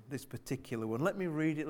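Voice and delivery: normal talking voice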